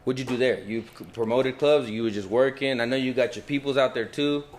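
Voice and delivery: ordinary talk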